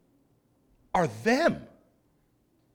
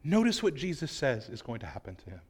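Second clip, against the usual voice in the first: normal talking voice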